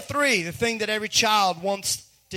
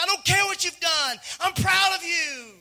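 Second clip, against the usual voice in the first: insistent fatherly pride; 8 semitones higher than usual. Acted. God insists over a listener's doubt.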